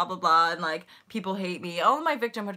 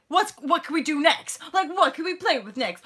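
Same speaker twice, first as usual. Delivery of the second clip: put-on high, louder childlike excitement; eager little kid; 7 semitones higher, 4 dB louder than usual. Voicing children running around, eager and excited. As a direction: pipe up like a kid eager to play.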